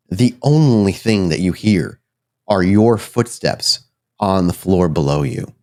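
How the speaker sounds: usual speaking voice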